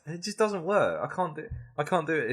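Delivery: ordinary talk, nothing marked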